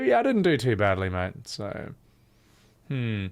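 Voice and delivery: usual speaking voice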